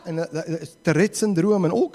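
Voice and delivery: ordinary talk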